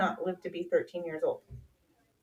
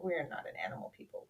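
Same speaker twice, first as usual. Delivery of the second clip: whispered aside; 6 semitones lower, 13 dB quieter, faster than usual. Retold or imitated. Aside, unvoiced.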